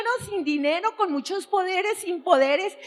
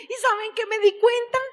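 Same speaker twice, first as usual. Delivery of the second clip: normal talking voice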